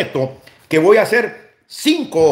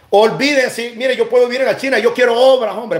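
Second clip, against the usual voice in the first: heated demand; 6 semitones higher than usual. Real, not acted. Demands the president's public works.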